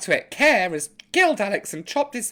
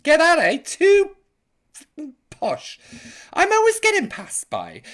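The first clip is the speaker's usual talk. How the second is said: mock indignant; 9 semitones higher, 7 dB louder than usual. Real, not acted. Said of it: "they said I was too posh".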